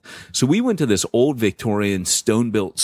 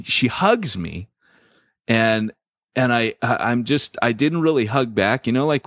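normal talking voice

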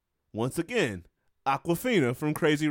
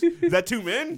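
high, louder mock of his surprised question; mimicking his inflection; 10 semitones higher, 5 dB louder than usual. Co-host mocks his partner's question, high and incredulous. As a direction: parrot his startled tone back at him. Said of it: "the inflection of your voice".

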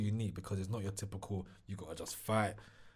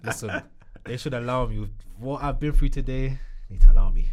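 much louder, half-laughing protest; loud plea; 2 semitones higher, 16 dB louder than usual. Real, not acted. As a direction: beg the audience to go easy. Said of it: "pleading after joke threat".

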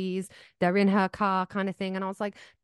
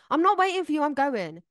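raised, higher snapping voice; fed up, cutting the friend off; retold angry outburst; 6 semitones higher, 4 dB louder than usual. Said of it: "I really remember a point where you did it to me so many times".

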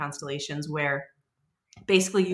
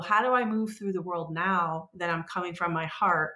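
ordinary talk

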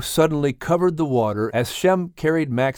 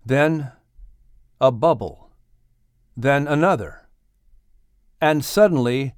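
usual speaking voice

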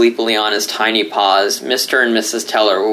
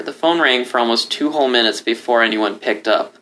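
ordinary delivery, nothing marked

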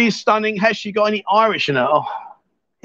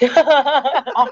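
robot voice; 6 semitones higher than usual. Pretend. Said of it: "I'm like a robot".

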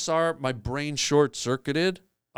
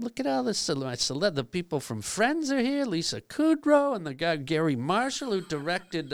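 much higher, excited mock Lovitz bragging; Jon Lovitz impression; 5 semitones higher than usual. Lovitz in the wings bragging about his club, high mock voice. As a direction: pitch it way up like a proud club owner. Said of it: "and he goes".

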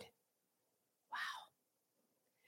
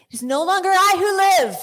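much higher and louder proclamation; preaching shout; 10 semitones higher, 12 dB louder than usual. Real, not acted. Preacher shouting scripture to congregation. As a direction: proclaim it full force.

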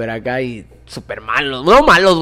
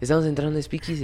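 excited hurry; 7 dB quieter, faster than usual. Retold or imitated. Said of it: "bien emocionado".

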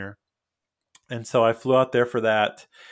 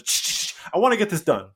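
impatient brush-off; 6 semitones higher, faster than usual. Retold or imitated. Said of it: "We're like".

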